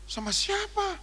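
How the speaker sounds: very high squeaked question; high-pitched mock astonishment; astonished question. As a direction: squeak in comic disbelief. Acted astonishment at secret payer.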